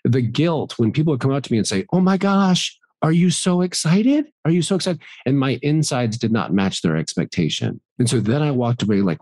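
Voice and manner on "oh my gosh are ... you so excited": high, eager gushing at a friend; imitating someone cooing over a big win; gushing fan. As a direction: mimic a fan's giddy excitement. People gushing at him after success.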